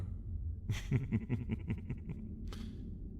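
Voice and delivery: quiet breathy laugh at its own joke; a hushed, pleased little laugh; soft chuckle. Acted. Dragon laughing about human flavor, hushed chuckle.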